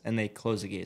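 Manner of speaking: ordinary delivery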